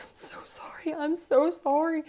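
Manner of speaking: mock tearful wail, climbing high and loud; imitated crying. Crying daughter apologizing to her mom, imitated wailing. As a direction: imitate a child sobbing out an apology. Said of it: "She's now crying".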